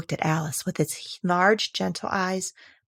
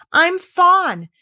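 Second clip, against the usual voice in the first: much higher, loud joyful cry; cry of delight; 11 semitones higher, 8 dB louder than usual. Acted. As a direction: burst out with sheer delight. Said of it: "it cried out in a voice of delight".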